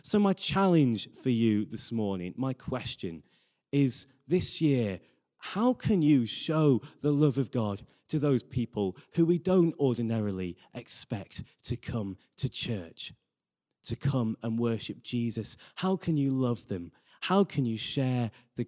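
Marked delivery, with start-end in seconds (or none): none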